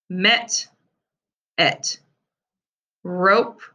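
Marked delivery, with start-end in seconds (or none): 1.6-2.0 "et" said with no M in front; just the "et" part, no M; beginning sound dropped. Shown as a demonstration. Teacher to pupils: "et" without its first sound. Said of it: "drop the beginning sound".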